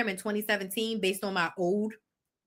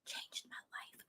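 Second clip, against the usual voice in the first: breathy whispered aside; awed whisper, almost no voice; 26 dB quieter, slower than usual. Real, not acted. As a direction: drop to a hushed whisper for the reveal. Dramatic aside about the event.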